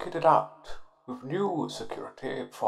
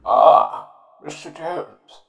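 posh English accent, put on; plummy upper-class posh accent; 3 semitones higher, 10 dB louder than usual.

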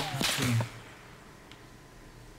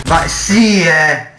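exasperated shout; 5 semitones higher, 6 dB louder, slower than usual. Real, not acted. Raging at the game.

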